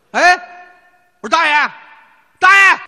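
high, loud call of alarm. Retold or imitated. Driver yelling back at his collapsed passenger, high and loud. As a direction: shout to wake the old man in back. Calling unresponsive old man.

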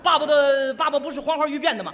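loud, rattled father grasping for an answer; hammed-up loud bluster; flustered blurting. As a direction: blurt it out in comic panic. Flustered father cornered by child's question.

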